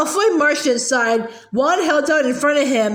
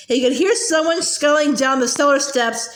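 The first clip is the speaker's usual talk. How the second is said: usual speaking voice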